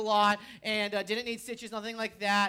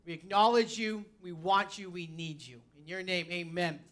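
earnest, continuous prayer in a lowered voice; praying; 5 semitones lower, 3 dB quieter than usual. Real, not acted. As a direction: pray to God, pitch settling lower toward the amen. Opens with a prayer.

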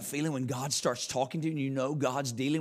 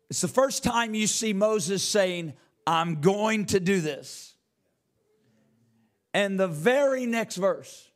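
slow, raised preacher's emphasis; preaching; 8 semitones higher, 6 dB louder, slower than usual. Acted. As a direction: drive each word home from the pulpit. Preaches at full force.

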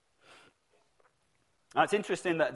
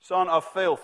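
crowd shouting; 3 semitones higher, 6 dB louder, slower than usual. Retold or imitated. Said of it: "And the crowd shouts".

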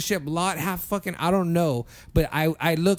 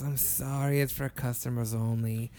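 soft, lower apology; unsure refusal; 6 semitones lower, 7 dB quieter than usual. Retold or imitated. Cashier refusing the restroom, soft and unsure. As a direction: turn them down quietly and uncertainly. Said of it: "real unsure".

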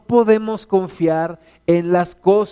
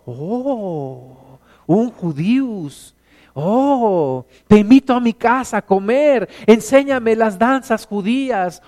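hammed-up excitement, higher and louder; mock amazed believers fawning over a visitor; mock gushing awe; 4 semitones higher, 4 dB louder, slower than usual. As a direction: gush like an awestruck fan. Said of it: "Algunos hermanos casi le hacen".

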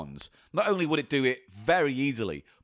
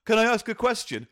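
higher, louder squeaky punter voice; mock nervous requester; imitating a punter; 5 semitones higher, 4 dB louder than usual. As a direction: fumble it like a flustered fan. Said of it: "they'll come up to you and say".

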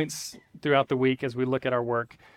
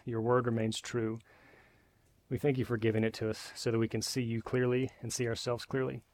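hushed, low prayer that fades softer; quiet prayer; 3 semitones lower, 6 dB quieter than usual. Acted. Pastor praying for the church, hushed and reverent. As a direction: pray it gently to the Father. Pastor prays before the sermon.

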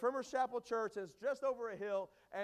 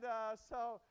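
ordinary talk, nothing marked

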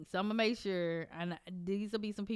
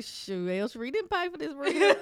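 mock host voice; 6 semitones higher, 7 dB louder than usual. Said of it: "They gonna be like".

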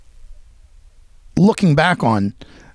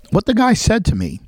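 ordinary delivery

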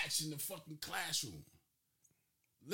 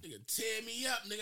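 usual speaking voice

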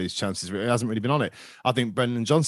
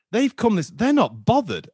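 higher, louder fed-up rant, slowing at the end; fan's retold outburst, pitched up; exasperated rant; 11 semitones higher, 5 dB louder, slower than usual. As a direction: vent at the lazy opposition. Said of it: "I said".

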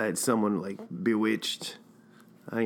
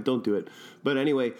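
ordinary delivery

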